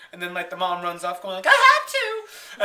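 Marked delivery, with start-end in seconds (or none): 1.5-2.6 high, loud, drawn-out wail; hammed-up shout of self-defence; mock desperate yell. Mom yells while running off.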